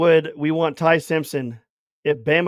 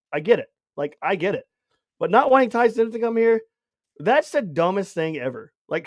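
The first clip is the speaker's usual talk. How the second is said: usual speaking voice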